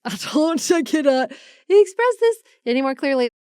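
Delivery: high, laughing exasperation; laughing insistence. Real, not acted. Traveler stressing how different two countries are, laughing insistence. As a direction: laugh through it, pitched well up.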